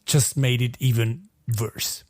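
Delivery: ordinary talk, nothing marked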